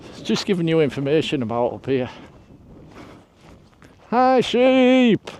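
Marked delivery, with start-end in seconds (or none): 4.1-5.3 an attempt at an Irish accent, high and loud; mock Irish accent. Hiker greets a sheep, imitating an Irishman's accent. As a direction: greet the sheep like an Irishman, but it drifts Scottish. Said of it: "every time I tried to mimic his accent".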